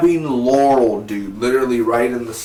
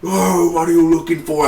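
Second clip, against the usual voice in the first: louder, higher, intense demand; intense Oliver; 6 semitones higher, 5 dB louder than usual. Retold or imitated. Reviewer acting out Oliver's interrogation, intense. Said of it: "he was so intense".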